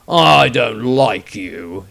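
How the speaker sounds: very slow, loud, deliberate; cold dislike. A narrator's reading. Witch hunter telling the wizard he dislikes him, slow menace. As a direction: drag out each word coldly. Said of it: "he added".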